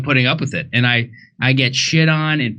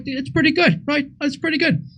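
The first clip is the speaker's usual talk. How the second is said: high-pitched approving comic impression; grudging praise in a high put-on voice; high, lighter impersonation of a comedian; Bill Burr; 13 semitones higher than usual. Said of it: "but I'm like".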